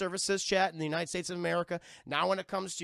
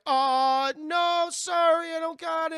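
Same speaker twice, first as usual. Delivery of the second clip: put-on much higher, slow, sheepish girl voice; 10 semitones higher, 11 dB louder, slower than usual. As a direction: play her shy and apologetic, up high. M T voiced in retelling.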